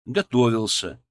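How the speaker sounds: ordinary delivery, nothing marked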